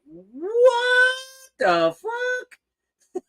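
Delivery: high squealing what the fuck; shocked disbelief. Retold or imitated. Shopper spotting metal musicians in the Walmart bin, high shocked squeal. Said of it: "and you're like".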